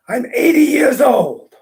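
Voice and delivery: put-on proud old man. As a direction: savour every year, proud and slow. Old man answers the priest.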